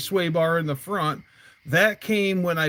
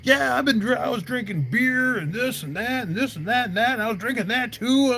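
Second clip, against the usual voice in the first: lightly higher, casual bragging; higher buddy voice; 7 semitones higher than usual. Retold or imitated. Buddy explaining his drinking, higher and offhand. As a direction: shrug it off, higher. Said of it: "buddy explains, retold".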